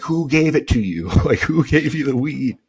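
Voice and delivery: high, loud imitated interrogation; mock angry wife. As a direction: act out an accusing wife yelling. Wife interrogating in acted quarrel.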